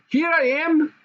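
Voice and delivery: put-on high, happy child's voice; little princess. A narrator's reading. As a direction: announce herself with delight. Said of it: "the princess cried".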